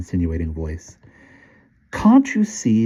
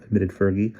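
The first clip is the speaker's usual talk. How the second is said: ordinary delivery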